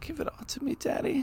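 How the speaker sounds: high, breathy seductive tease; sultry daddy line. Pretend. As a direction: purr it like a flirt. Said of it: "I'll let Kevin say it".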